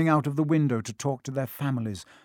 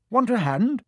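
cheery twin; 9 semitones higher, 4 dB louder than usual. A narrator's reading. Twin offering Harry help.

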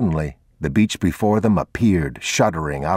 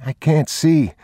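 put-on high child's voice; uneasy little boy, pitched high; young boy; 10 semitones higher, 3 dB louder than usual. A narrator's reading. Boy telling his father in the dark, high childlike voice.

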